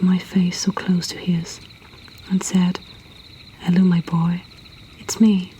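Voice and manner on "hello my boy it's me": ordinary delivery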